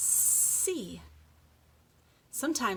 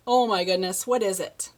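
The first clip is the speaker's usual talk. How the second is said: mock surprise; 9 dB louder than usual.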